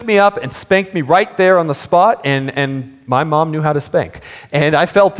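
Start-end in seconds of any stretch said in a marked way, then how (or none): none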